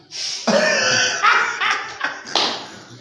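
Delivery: a long, high burst of laughter; real laughter. Real, not acted. Laughing at his own joke about being a printer, real laughter. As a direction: crack up for real.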